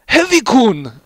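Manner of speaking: high, loud surprised cry; surprised gasp. A narrator's reading. Medic startled as Heavy walks in, loud high cry. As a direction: blurt his name in shock. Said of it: "Medic said in surprise".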